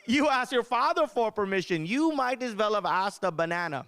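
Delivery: hammed-up indignant Indian mom; mad mom. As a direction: ridicule him as an angry Indian mother. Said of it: "never seen her this mad before".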